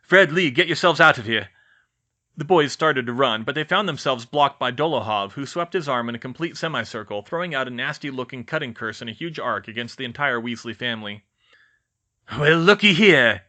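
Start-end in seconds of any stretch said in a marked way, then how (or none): 0.0-1.5 loud, high command; urgent order. Acted. Commanding escape. 12.4-13.4 slow, loud menacing drawl; growling villain. Acted. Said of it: "he growled".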